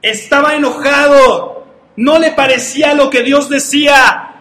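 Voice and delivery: loud, slow preaching of his anger; preached anger. Acted. Preacher booming Naamán's anger to the church. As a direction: thunder it out to the congregation.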